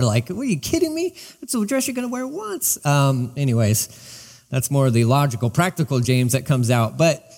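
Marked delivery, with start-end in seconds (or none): 0.0-2.8 mock disbelief. Mocking pricey wedding dress.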